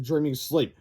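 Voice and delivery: ordinary delivery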